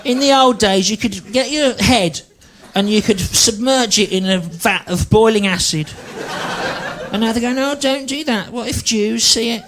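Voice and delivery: put-on high, strained, ranting old bigot; mock ranting bigot. Comic mocking a bigoted nan, high ranting voice. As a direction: whine it like a paranoid Daily Mail reader.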